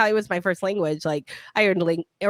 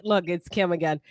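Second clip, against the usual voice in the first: normal talking voice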